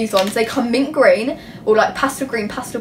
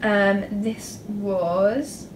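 very slow, "was" held and rising; drawn-out suspense; 4 dB quieter, much slower than usual. Real, not acted. Vlogger remembering a folder's price, stretching it out in suspense. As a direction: stall before the big reveal. Drum-roll price reveal, second try.

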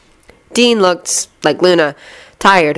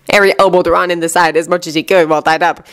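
plain reading voice

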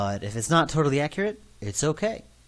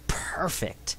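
whisper; 5 semitones higher, 5 dB quieter than usual. Real, not acted. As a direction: breathe Perfect as a soft whisper. Whispered echo of perfect.

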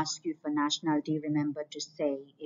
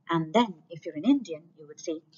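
ordinary talk, nothing marked

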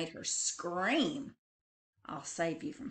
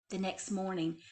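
ordinary delivery, nothing marked

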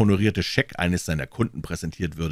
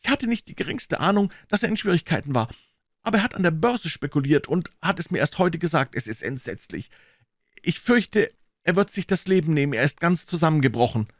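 mildly agitated visitor, pitched up; lightly voiced worried man; distressed brother; 10 semitones higher than usual. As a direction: confess the family trouble with some agitation.

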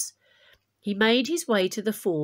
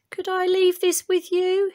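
much higher and louder messenger; lively messenger asking a favour, higher and louder; 11 semitones higher, 6 dB louder than usual. Acted. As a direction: pitch the messenger up high. Messenger voiced in story.

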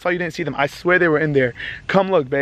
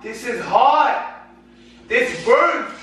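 higher, drawn-out protest at a burn; pained cry; 4 semitones higher, much slower than usual. Real, not acted. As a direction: yelp it out as hot liquid burns. Coffee spilled on him, burning.

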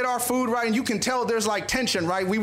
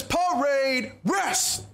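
long drawn-out parade-ground command, pitched up; drill command; 4 semitones higher, much slower than usual. Retold or imitated. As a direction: call the order out slowly, stretching each word. Drill instructor calls out a command.

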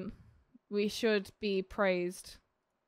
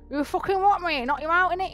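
lightly voiced chav, higher pitched; chavvy; 7 semitones higher, 4 dB louder than usual. Explaining chavvy to the audience, mock chav talk. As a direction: put on a bit of chav bravado. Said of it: "Chavvy is like".

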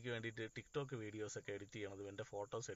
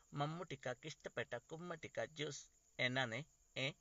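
higher-pitched comic film-character voice with silly laughs; Soubin Shahir impression; 5 semitones higher, 5 dB louder than usual. Crispin reminding Mahesh chettan who he is, star impression. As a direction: mimic an eager, chatty character, pitched up. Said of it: "Soubin സാഹിറിൻ്റെ ശബ്ദം അനുകരിക്കാം".

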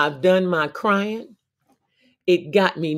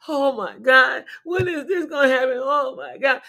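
mock despair; 6 semitones higher than usual. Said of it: "To be saddened and down".

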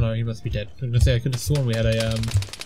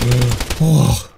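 startled outburst; 10 dB louder than usual. Real, not acted. Startled by sight round corner.

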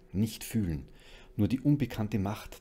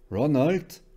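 loud, high call to husband; raised, carrying voice; 7 semitones higher, 10 dB louder than usual. A narrator's reading. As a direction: call out for someone in another room. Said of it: "rief sie".

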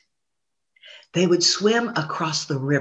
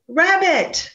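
ordinary delivery, nothing marked

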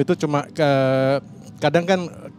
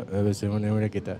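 lower, mumbled imitation of a guest; imitated mumbling; 5 semitones lower, 4 dB quieter than usual. Podcast engineer imitating an unclear talker, low mumble. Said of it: "siapa gitu yang ngomongnya".

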